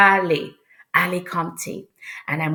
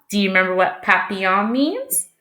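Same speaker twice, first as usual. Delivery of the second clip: ordinary talk, nothing marked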